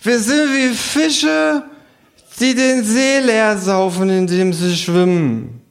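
put-on very high, slow, drunken kangaroo. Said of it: "sagt das Känguru".

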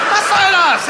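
high, loud acted outburst; sudden yell of indignant protest; outraged shout. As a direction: explode in mock outrage, pitch climbing. Acted sudden outburst after mama joke.

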